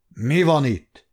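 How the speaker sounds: mildly gruff, put-on character voice; understated grumpy outburst; grumpy boom. A narrator's reading. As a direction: demand it crossly, just woken. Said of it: "dörrent az összegyűltekre morcosan".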